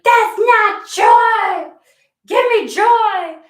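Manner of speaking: very high, loud, drawn-out tantrum; demanding mock wail. Querent rejecting what Spirit sends, loud demanding wail. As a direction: bellow it high and slow in mock outrage.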